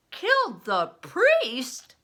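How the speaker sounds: loud, slow, high shocked cry; cried out. Acted. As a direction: blurt it out in shock. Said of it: "he cried".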